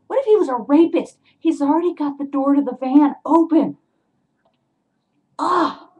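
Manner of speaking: high, loud incredulous outburst; appalled at your own recklessness; worked-up and loud, ending in an exclamation; exasperated. Real, not acted. Exasperated at her own stupidity.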